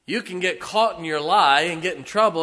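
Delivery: loud, high, driving pulpit voice; preacher thundering at the pews; fiery preaching. Acted. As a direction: hammer the point home to the congregation. Preaching at full force.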